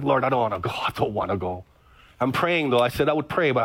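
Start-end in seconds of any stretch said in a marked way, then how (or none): 0.0-1.6 reluctant prayer. Retold or imitated. Pastor reluctantly praying on the rock, low and pleading. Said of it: "I'm like".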